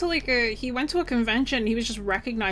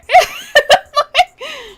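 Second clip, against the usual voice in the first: giggling laugh; 13 dB louder than usual. Real, not acted. Laughs at own hooray.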